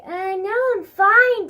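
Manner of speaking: high, loud, drawn-out mock denial; awkward denial. Child brushing off dad at dinner, hammed-up awkward denial. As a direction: overplay insisting you're fine.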